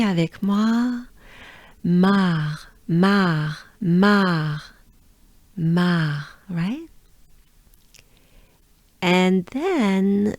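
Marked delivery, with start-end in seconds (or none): 1.8-6.3 mar repeated, the R only a breath; French R. Shown as a demonstration. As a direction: model mar slowly with a breathed throat R. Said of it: "take a good breath with me".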